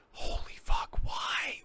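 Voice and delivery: breathless whispered disbelief; stunned whisper. Real, not acted. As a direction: let it slip out as a shocked whisper. Stunned by the four hundred thousand.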